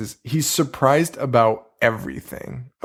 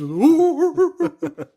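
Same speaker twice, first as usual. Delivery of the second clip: hooting mummy ooh noises, much higher pitch; dumb mummy noises; slower than usual. Retold or imitated. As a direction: moan like a clueless mummy getting shocked. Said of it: "making the dumbest noises".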